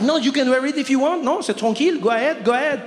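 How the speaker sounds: high mock-generous French accent; sweetly passive-aggressive Parisian. Salesman mock-permitting the jacket, high French accent.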